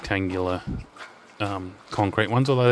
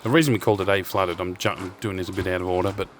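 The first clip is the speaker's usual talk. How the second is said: ordinary talk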